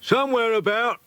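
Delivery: calling out high across the yard; shouted back. A narrator's reading. Harry shouting back to Peter while saddling a horse. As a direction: yell it over a shoulder. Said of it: "he shouted back".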